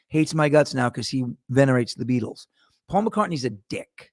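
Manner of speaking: ordinary talk, nothing marked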